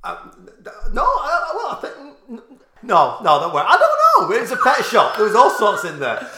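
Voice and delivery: high, loud, laughing defensiveness; squeaky-high exasperated denial, half laughing; flustered laughing protest. Real, not acted. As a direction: protest through laughter when caught out. Flustered when challenged on piranhas.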